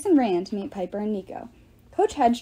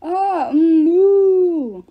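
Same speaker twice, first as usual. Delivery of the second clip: loud, high, mushy wordless cry; a melted face trying to shout; garbled cry; 7 semitones higher, 9 dB louder, much slower than usual. A narrator's reading. Otis warning his brother through a dissolved face, garbled loud cry. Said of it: "his half-dissolved face could only manage".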